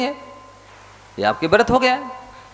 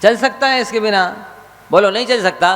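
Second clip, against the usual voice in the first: high, insistent sermon pleading; a monk exhorting his listeners; fervent preaching; 9 semitones higher than usual. Acted. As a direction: press them to take the vow. Rhetorical challenge to congregation.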